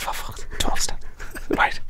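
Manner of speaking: whispered, disbelieving; whispered aside. Retold or imitated. Whispering to himself as the twelve-step program starts. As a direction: whisper it under your breath in dismay. Muttered reaction in the circle.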